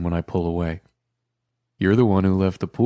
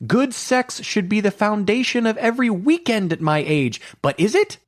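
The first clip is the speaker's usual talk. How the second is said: yells at the ceiling; 3 dB louder than usual. A narrator's reading. Woman yelling her frustration at the ceiling, high and loud. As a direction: throw the words up at the ceiling in exasperation. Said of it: "yells at the ceiling".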